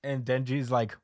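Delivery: ordinary talk, nothing marked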